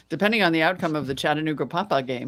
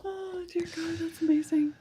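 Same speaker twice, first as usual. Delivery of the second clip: much higher, much softer gasping delight; breathless amazement; 12 semitones higher, 9 dB quieter than usual. Real, not acted. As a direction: gasp it out, overwhelmed. Stunned by the joke.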